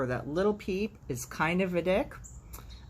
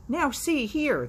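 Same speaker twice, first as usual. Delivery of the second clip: high, loud pompous cock; indignant rooster; 10 semitones higher, 5 dB louder, slower than usual. Acted. Cock scolding Little Peep, high and loud. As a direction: puff up and scold.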